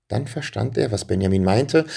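plain reading voice

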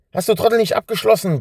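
high, loud, fast roar; bellow. A narrator's reading. Thief roaring at his partner over the unlocked door. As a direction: bellow at the fool. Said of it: "brüllte der andere".

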